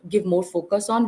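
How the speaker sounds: normal talking voice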